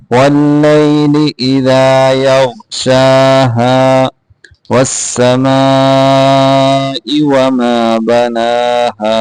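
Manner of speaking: slow, measured tajweed chant; Quran recitation. Shown as a demonstration. Teacher recites the verse for students, slow tajweed chant. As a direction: recite slowly, drawing out the vowels.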